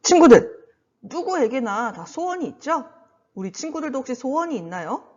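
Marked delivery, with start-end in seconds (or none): 0.0-0.6 calling out. Real, not acted. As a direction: call the group loudly. Calling out to the children.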